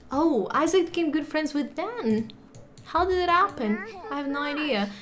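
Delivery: ordinary delivery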